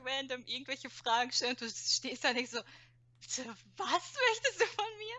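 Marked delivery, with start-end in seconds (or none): 3.2-5.2 incredulous. Retold or imitated. Mocking a random questioner.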